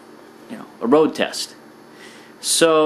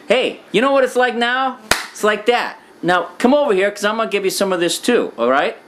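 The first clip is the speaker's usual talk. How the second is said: menacing call; 8 semitones higher than usual. Acted. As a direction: lure them in with a threat. Guy threatening after operation.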